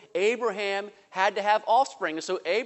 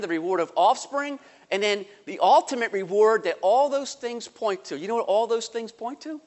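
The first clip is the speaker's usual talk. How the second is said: ordinary delivery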